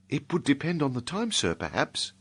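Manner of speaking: put-on a bashful serving girl answering the gentleman; shy inn maid.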